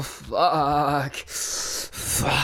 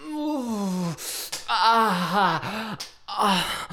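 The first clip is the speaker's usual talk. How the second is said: higher, crying moans; 6 semitones higher, much slower than usual. Acted. Lover overwhelmed by partner, crying moans. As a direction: let out needy high moans.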